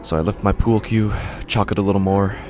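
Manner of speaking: ordinary delivery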